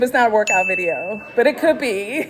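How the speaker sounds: giggling through the words; laughing. Real, not acted. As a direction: let the joke crack you up. Laughs at her workout joke.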